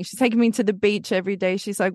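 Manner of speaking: usual speaking voice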